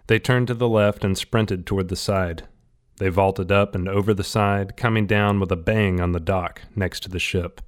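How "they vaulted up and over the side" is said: ordinary delivery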